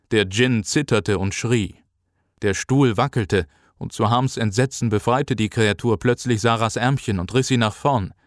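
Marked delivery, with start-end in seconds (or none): none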